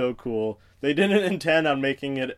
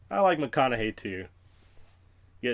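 normal talking voice

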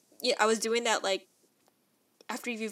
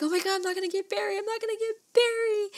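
much higher, fast, imitating crying panic; panicked wail; 12 semitones higher, 4 dB louder, faster than usual. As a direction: replay the despair of losing the dog. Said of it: "crying and shaking".

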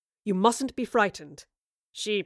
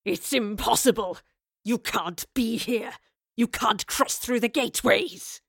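furious disbelief; slower than usual. A narrator's reading. Raleigh panting with surging anger.

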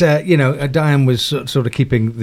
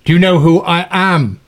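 pompous producer; 6 semitones higher, 4 dB louder than usual. Retold or imitated. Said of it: "And he goes".

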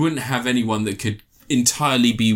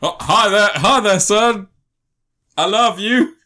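higher and louder beaming dad; cheery dad voice; 8 semitones higher, 5 dB louder than usual. Retold or imitated. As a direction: greet him like a doting father. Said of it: "and goes".

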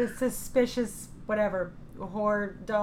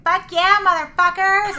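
wild shout; 13 semitones higher, 12 dB louder, slower than usual. Retold or imitated. Drunk star stands and cheers.

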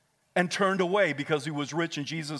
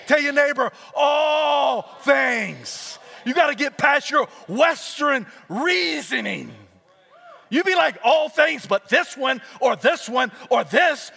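shouted, high preaching cadence; preaching at full force; 9 semitones higher, 6 dB louder, slower than usual. Acted. Preacher urging congregation, loud full-force preaching. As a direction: thunder it at the crowd.

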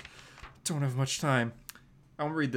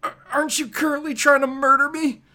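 higher and louder incredulous jab; sarcastic retort; 11 semitones higher, 8 dB louder than usual. Acted. Peter snarking back at the Vulture who is trying to kill him. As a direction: throw it back at him with disbelief.

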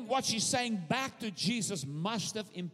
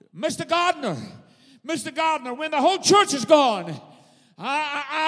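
high, rising desperate appeal; impassioned plea; 7 semitones higher, 7 dB louder than usual. Acted. Preacher as Mary begging the gardener, impassioned plea. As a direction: plead loudly, stumbling on the "I".

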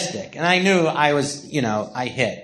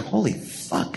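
hushed, stunned whisper-curse to himself; awed inner curse; 3 semitones higher, 6 dB quieter, slower than usual. Retold or imitated. Thinking it while showing [name] his character, hushed awe. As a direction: breathe it out in disbelief.

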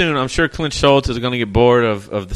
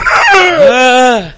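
sudden loud startled yell; 12 semitones higher, 12 dB louder than usual. Real, not acted. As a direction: yelp out in surprise. Fake alien startles friend.

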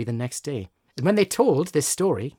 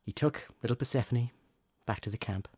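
fireside storytelling; 4 semitones lower than usual. Acted. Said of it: "You've got your storytelling voice on".

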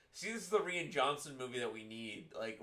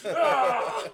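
high, loud hammed-up yell; mock rage scream; 13 dB louder than usual. Imitating the raging movie dad, mock scream. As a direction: let out an exaggerated roar of rage. Acting out film rampage.

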